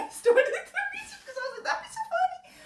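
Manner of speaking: laughing through the words. Real, not acted. Friend laughing about a silly photo plan. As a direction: giggle your way through it.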